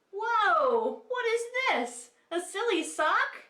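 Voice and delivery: high, loud whoa of playful surprise; hammed-up surprise for kids; mock surprise. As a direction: gasp at the sock like it's a shock. Teacher surprised by silly sock.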